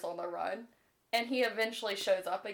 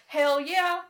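eager hell yeah; 6 semitones higher, 9 dB louder than usual. Retold or imitated. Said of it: "She's like".

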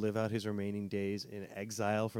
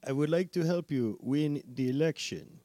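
put-on weak Russian-English accent, a bit higher and louder; bad Russian accent; 5 semitones higher, 4 dB louder than usual. Host plays Putin offering election help, in a bad Russian accent. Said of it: "he had a much better Russian English accent".

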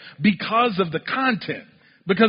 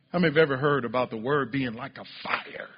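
ordinary talk, nothing marked

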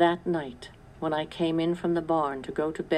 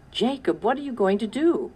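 rising, louder frightened plea; alarmed cry; 7 semitones higher, 4 dB louder than usual. A narrator's reading. Sarah seeing Jacob with the rifle, alarmed cry. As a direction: call out to stop him. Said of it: "cried Sarah".

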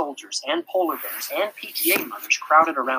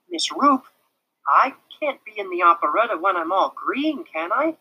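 higher, hopeful question; hopefully; 6 semitones higher, 4 dB louder than usual. A narrator's reading. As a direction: angle for the answer he wants. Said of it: "he asked hopefully".

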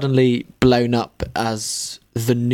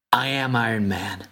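Iron Man line; 4 semitones lower than usual. Shown as a demonstration. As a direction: deliver it like a film hero. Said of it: "I'm gonna say it again".

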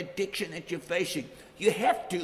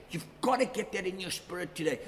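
ordinary delivery

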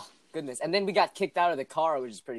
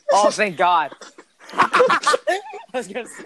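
relieved laugh; 7 semitones higher, 5 dB louder, slower than usual. Real, not acted.

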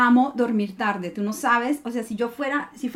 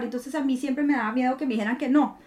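ordinary talk

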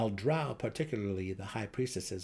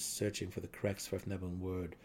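ordinary delivery, nothing marked

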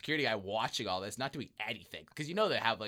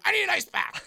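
much higher, louder frustrated repeat; 7 dB louder than usual. Retold or imitated. Friend repeating the demand to the concierge, frustrated. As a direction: push harder, fed up now. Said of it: "getting frustrated".

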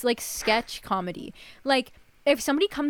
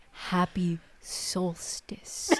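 slow, low, quiet deadpan; deadpan trolling; 4 semitones lower, 7 dB quieter, much slower than usual. Retold or imitated. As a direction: drop it softly and slowly to needle them. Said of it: "And then you're like".

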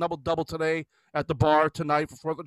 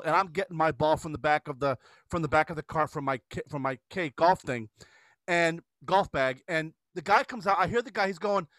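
normal talking voice

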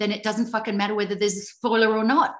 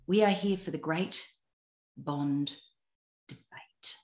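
dramatic hush; 3 semitones lower, 6 dB quieter than usual. Acted. Dramatic announcement.

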